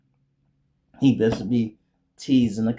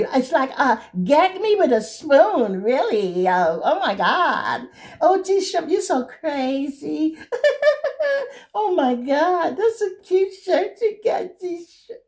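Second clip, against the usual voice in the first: put-on high, slow, squealing Valley Girl voice with giggles; 5 dB louder than usual. Phony girl gushing over Shrimp, hammed-up Valley Girl squeal. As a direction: ham up a ditzy Valley Girl. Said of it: "Like the Valley Girl".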